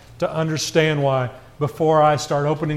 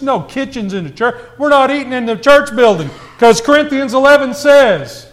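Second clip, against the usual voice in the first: higher-pitched imitated objector; mock indignation; 6 semitones higher, 3 dB louder than usual. Preacher mimicking people against church kitchens, mock indignant. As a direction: parody a rule-keeper laying down the law.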